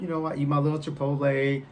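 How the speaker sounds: ordinary delivery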